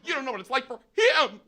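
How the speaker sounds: very high, escalated outrage; high mock scream, hitting HIM hard; imitated shrill yelling. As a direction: yell it in a hammed-up furious woman's voice. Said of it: "She kept yelling at me".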